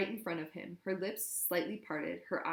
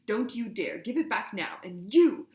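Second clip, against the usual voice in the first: loud, biting commands; furious order; 4 semitones higher, 9 dB louder than usual. A narrator's reading. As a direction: spit it out in rage. Hermione raging at Harry, Ron.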